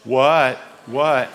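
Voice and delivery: mild defensive "What? What?". Retold or imitated. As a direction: answer like you've been caught. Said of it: "then you feel like".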